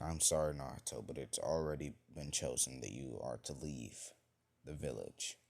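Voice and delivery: soft, low and subdued; mildly sorrowful, voice sinking quietly; regretful. Acted. Leader banishing a boy from the village, regretful.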